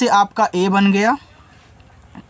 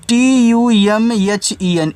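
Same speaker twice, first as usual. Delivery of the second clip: letters spelled out one by one, slowly and louder; slow letter-by-letter spelling; 3 semitones higher, 3 dB louder, much slower than usual. Shown as a demonstration. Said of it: "ये लिखा हुआ आपका तुम है".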